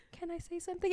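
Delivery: teasing a barely audible friend; mock soft voice. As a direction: imitate her tiny mic voice. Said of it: "it's not A S M R".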